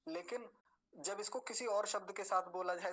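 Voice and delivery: ordinary talk, nothing marked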